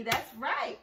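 ordinary delivery

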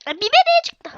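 high, loud burst of surprise; surprised exclamation. Real, not acted. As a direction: exclaim it, pitch leaping up. Something suddenly appears in game. (şaşkın, tiz bir haykırış)

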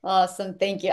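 normal talking voice

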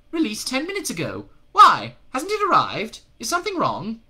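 high, loud questioning; suspicious demon. A narrator's reading. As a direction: demand answers, sharp and high.

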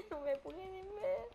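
delighted mischief; gleeful laughing. Real, not acted. Gamer hitting unseen players, laughing high.

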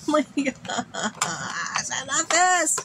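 laughing, high-pitched delighted gush; giddy delight. Real, not acted. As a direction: laugh through it and rise to a squeal. Delighted unboxing of makeup gift.

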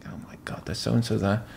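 awed whisper to himself. Retold or imitated. Whispering on spotting famous faces in the room. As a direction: breathe it out, starstruck. Starstruck among TV celebrities.

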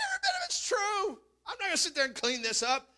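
playfully voicing an unbothered Paul; quoting Paul. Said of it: "He was like".

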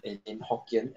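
usual speaking voice

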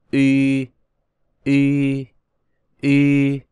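long, level Y as ü, lips rounded and unmoving; lips held in place. Shown as a demonstration. Teacher models the correct vowel, Y held without moving the lips. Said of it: "Phải giữ nguyên cái vị trí của cái môi".

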